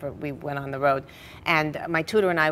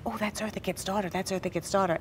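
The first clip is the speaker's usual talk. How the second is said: gossiping whisper; 2 semitones higher, 5 dB quieter than usual. Retold or imitated. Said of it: "are whispering".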